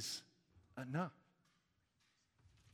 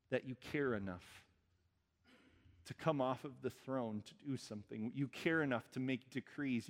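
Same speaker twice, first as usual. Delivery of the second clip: prayer. Acted. Leads a closing prayer.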